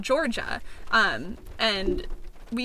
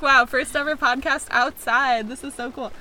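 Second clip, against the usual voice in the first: mock excitement; 3 semitones higher, 6 dB louder than usual. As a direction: overdo the thrill of it. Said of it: "we were gonna be like".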